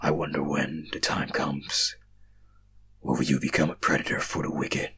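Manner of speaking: low, slow, breathy whisper of a hidden being; deep whisper. Acted. Mysterious voice warns the dreamer, low whisper. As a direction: menace them from the dark in a low whisper.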